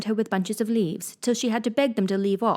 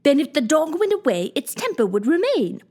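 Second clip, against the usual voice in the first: high, louder, crowing over the answer; triumphant exclamation; 7 semitones higher, 4 dB louder than usual. Acted. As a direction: win the argument out loud. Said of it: "the Queen exclaimed triumphantly".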